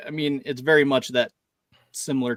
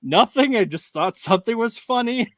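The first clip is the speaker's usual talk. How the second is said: laughing reply; 9 semitones higher than usual. Retold or imitated. Answering his daughters, still laughing.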